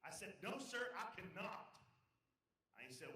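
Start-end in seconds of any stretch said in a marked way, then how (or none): 0.5-1.7 loud, slow, emphatic refusal; defiant refusal. Acted. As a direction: stand firm, every word drawn out. Driver refuses agent more firmly.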